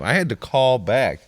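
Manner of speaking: ordinary delivery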